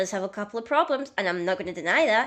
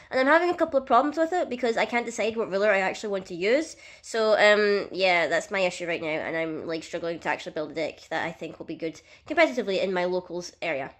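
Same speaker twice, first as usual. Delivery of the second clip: ordinary delivery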